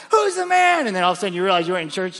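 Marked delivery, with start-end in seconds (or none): 0.1-0.9 much higher, louder joyful call-out; excited shout. Retold or imitated. Forgetting you're not in church, joyful shout-out. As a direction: blurt it out, thrilled. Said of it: "you're like".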